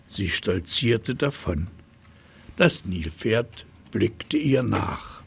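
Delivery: usual speaking voice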